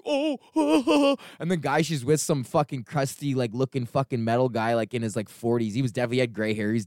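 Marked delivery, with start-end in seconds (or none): 0.1-1.2 high surprised gasp of a word; mock startled oh. The woman caught off guard, high startled oh. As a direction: squeak it out startled. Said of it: "she was like".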